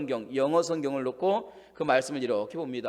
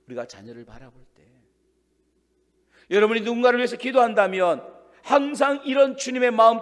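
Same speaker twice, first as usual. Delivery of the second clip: ordinary talk, nothing marked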